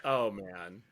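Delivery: low deflated pity; mock pity. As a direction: sigh it like a letdown. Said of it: "like, Southern for".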